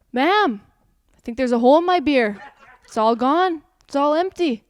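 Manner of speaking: loud, fast corny joke; a dad proud of his beer joke; joking customer. Acted. Customer with an empty beer teasing the waitress, loud and pleased.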